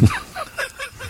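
high squeaky giggle; giggling before the punchline. Real, not acted. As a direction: burst into a giggle at the memory. Laughs at own story.

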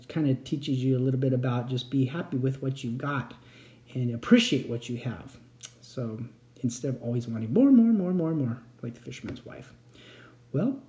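ordinary delivery

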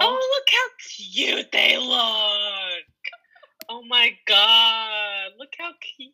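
slow, drawn-out cooing delight; real gushing adoration. Real, not acted. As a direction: squeal over something adorable, stretching the words. Gushing over a cute picture.

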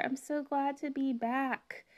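ordinary talk, nothing marked

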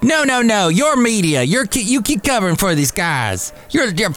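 high, indignant imitated tirade; worked-up, high-pitched protest, sputtering out; mock shout. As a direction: mimic a senator blowing up at the media. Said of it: "he shouted".

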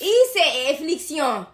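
slow mock French, "affliction" as "afflik-syon"; French accent. Co-host quoting the film, slow French accent. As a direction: draw out a hammed-up French accent. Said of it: "to do the French accent".

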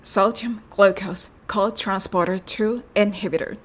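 very slow, careful English; slow English demo. Shown as a demonstration. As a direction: Sodium glucose co-transporter two inhibitor, word by word. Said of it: "それではやってみましょう".

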